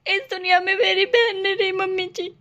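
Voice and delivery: tearful, sobbing through the line; crying. Acted. Daughter-in-law to mother-in-law, crying over her sister. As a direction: weep as you tell her. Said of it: "रो मत, तू अब बहुत रो ली".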